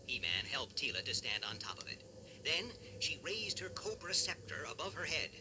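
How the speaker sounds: plain reading voice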